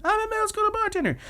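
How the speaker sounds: very high, rushed squeaky recital; forced repeat. Retold or imitated. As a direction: rattle it off in a tiny high voice.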